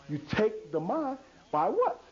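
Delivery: usual speaking voice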